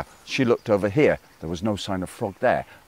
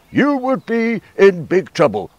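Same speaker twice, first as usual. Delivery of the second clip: higher, louder, slow warning; frog's warning; 9 semitones higher, 5 dB louder than usual. Acted. Frog warning crow about her mother, slow loud warning. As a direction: draw out the threat. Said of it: "said Frog".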